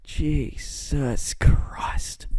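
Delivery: slow unvoiced whisper. Real, not acted. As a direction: breathe it out embarrassed. Embarrassed at himself.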